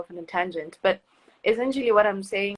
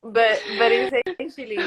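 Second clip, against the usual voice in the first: laughing; 4 semitones higher, 4 dB louder than usual. Real, not acted. Laughing at kitchen comment.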